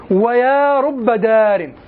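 drawn out very slowly, each word weighed; slow recitation. Shown as a demonstration. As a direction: savor every word of the phrase. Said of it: "هذا يفيد التكثير".

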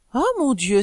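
acted startled outcry; astonished exclamation. A narrator's reading. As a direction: cry out as if caught by surprise. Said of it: "s'écria-t-il".

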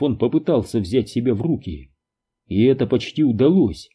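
plain reading voice

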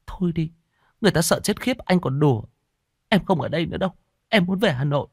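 high, upset complaining voice; upset whining. A narrator's reading. Frightened girlfriend complains to her joking boyfriend. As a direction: whine that he's joking while she's scared.

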